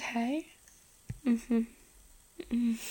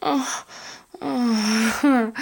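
moan; 9 dB louder than usual. Acted. Aroused outburst.

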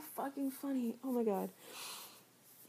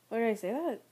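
ordinary talk, nothing marked

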